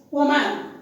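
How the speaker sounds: "woman" with a full "man" ending; common mispronunciation. Shown as a demonstration. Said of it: "and they pronounce it as".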